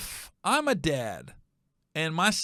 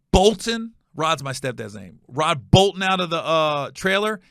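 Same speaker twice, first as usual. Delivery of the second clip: ordinary talk, nothing marked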